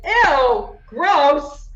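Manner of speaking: loud, high disgusted "ew, gross". Acted. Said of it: "She's like".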